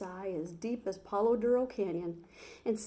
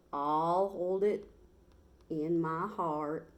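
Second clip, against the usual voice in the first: slow, stretched Texas cowboy drawl; 2 semitones lower, slower than usual. Acted. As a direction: lean back into a lazy Texas drawl. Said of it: "in his slow Texas drawl".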